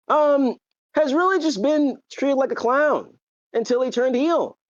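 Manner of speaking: normal talking voice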